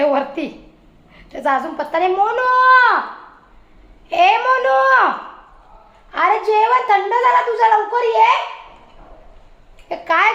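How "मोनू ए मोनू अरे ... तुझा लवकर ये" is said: calling out. Real, not acted. Mother calling her son upstairs down for dinner, high raised call.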